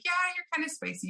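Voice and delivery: a high, quick, sweetly teasing line; playful gentle tease; said nicely. Retold or imitated. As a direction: soften the blow, light and high. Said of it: "I always like to say it kinda nicely".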